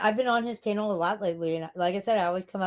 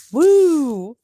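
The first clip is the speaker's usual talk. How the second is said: loud high party whoop; rowdy party animal; 8 semitones higher, 13 dB louder than usual. Acted. Reacting to his text, party cheer.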